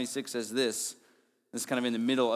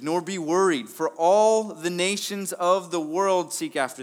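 louder and higher, slow and weighty; preaching scripture; 6 semitones higher, 7 dB louder than usual. Acted. Preacher reading verses to the church, forceful and slow. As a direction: read it as a stirring command.

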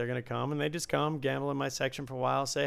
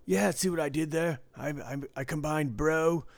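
Yes Man character; 3 semitones higher than usual. Retold or imitated. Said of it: "it's like the guy in Yes Man".

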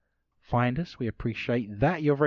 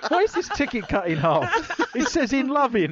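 high mock voice; 11 semitones higher than usual. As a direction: voice raised high, puzzled. Acting out a confused valentine.

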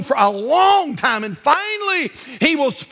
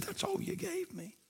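quiet Lord voice; 6 semitones higher, 22 dB quieter than usual. Acted. The Lord gently telling the homeowner, hushed and quiet. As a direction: speak it softly, like a patient Lord.

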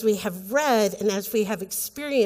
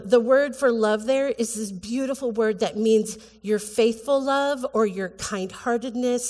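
usual speaking voice

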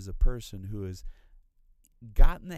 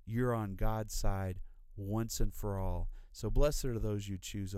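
ordinary talk, nothing marked

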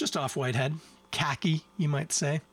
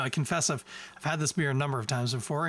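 usual speaking voice

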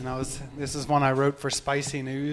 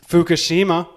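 loud; 3 semitones higher, 9 dB louder than usual. Acted. Loud joke setup.

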